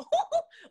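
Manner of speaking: high giddy laugh; high squealing laugh. Retold or imitated. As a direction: laugh that jumps way up. Squeal at the deal.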